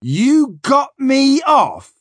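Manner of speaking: slow, high-pitched incredulous shout; furious disbelief. A narrator's reading. Captain throwing Flashman's claim back, furious disbelief. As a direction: stretch each word out in outraged disbelief. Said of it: "glared at me".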